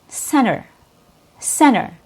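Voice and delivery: "center" with the T dropped after the N; disappearing T. Shown as a demonstration. Teacher giving the first example of the disappearing T. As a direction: say "center" as "cenner", first syllable stressed. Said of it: "talk about the disappearing T".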